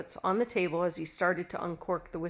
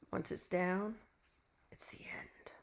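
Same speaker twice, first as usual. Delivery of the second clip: hushed sinister whisper; menacing whisper; 9 dB quieter than usual. Acted. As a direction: breathe it out like a threat. Gloating over deadly poison.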